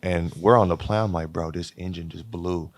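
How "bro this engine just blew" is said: hushed alarm. Retold or imitated. Player quietly tells sleepy teammate the engine blew, hushed alarm. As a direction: murmur the bad news to a half-asleep teammate.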